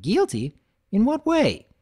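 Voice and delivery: a baffled farm pony; surprised Applejack. Acted. Applejack asks.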